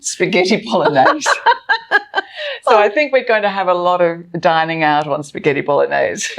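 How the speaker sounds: punchline through real laughter; laughing at her own joke. Real, not acted. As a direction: giggle out the answer.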